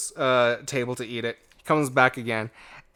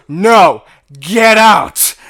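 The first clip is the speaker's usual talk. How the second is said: much louder, higher "get out", with the voice changed; the manager's final order, shouted out at full volume; full retold shout; 7 semitones higher, 14 dB louder, much slower than usual. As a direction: bellow him out of the store. Manager throws customer out.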